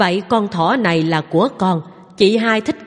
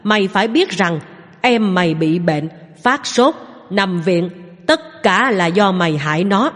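usual speaking voice

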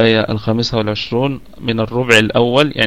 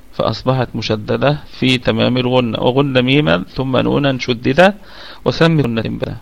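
ordinary talk

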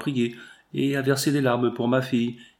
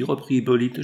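plain reading voice

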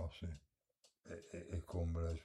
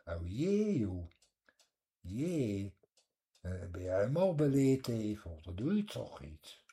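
broad Scheveningen fisherman's dialect, higher and louder; Scheveningen accent; 5 semitones higher, 5 dB louder than usual. Shown as a demonstration. Showing the listener how Schevenings sounds, in broad Scheveningen dialect. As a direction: lay on thick Scheveningen dialect, dropping the h. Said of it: "Zal ik eens een stukje Schevenings spreken".

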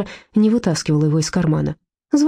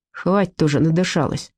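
ordinary delivery

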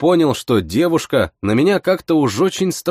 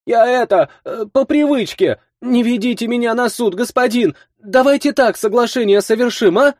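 put-on high, flustered girl voice; pleading girl voice; 12 semitones higher than usual. A narrator's reading. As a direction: stammer out like a caught young thief.

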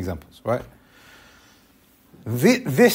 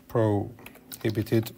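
"prohibited" drawn out very slowly and softer; slowed, stressed repeat; 4 semitones lower, 7 dB quieter, much slower than usual. Shown as a demonstration. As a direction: stretch "prohibited" out to drive it home.

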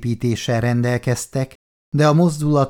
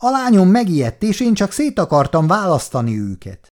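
raised pleading voice; 7 semitones higher than usual. Acted. Chris explains to angry men.

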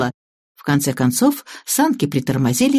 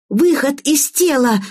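put-on yelling girl; 9 semitones higher, slower than usual. A narrator's reading. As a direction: call out slowly in high-pitched excitement. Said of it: "завопила девочка". (высокий протяжный детский возглас)

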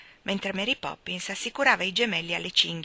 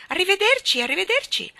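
high, loud gushing lady; shrill Miss Lark; 13 semitones higher, 8 dB louder than usual. A narrator's reading. Miss Lark greets the children, high and gushing. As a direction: chirp it like a fussy society lady. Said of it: "salutò con le spalle voltate".

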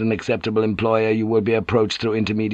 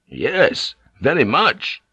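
eager yes, pitch lifting higher; a rush of eager hope, rising; hopes soaring; 5 semitones higher, slower than usual. A narrator's reading. Yabu answering Toranaga's offer of provinces, eager and rising. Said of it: "his hopes soaring".

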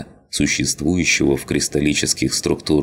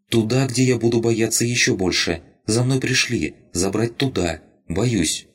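higher, repeated scared little voice; frightened rabbit; 8 semitones higher than usual. Acted. Rabbit tells Lina it is afraid, higher frightened voice. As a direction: tremble out the fear like a tiny creature. Rabbit fears being taken.

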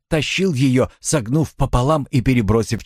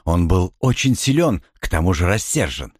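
usual speaking voice

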